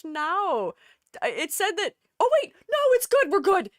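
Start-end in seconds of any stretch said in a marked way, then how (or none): 2.2-3.7 much higher, louder relieved burst; sudden joyful relief; excited relief. Real, not acted. Program suddenly works.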